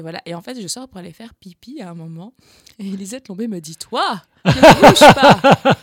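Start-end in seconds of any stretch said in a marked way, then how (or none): 3.8-5.8 slow, very loud, drawn-out command; mock stern stage command; stern order. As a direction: stop her dead with each word. Artist orders her to freeze.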